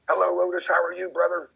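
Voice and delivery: bright, high friendly hello; cheery greeting. Retold or imitated. Narrator greeting his neighbor Otis, high cheerful hello. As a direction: greet your buddy warmly and high.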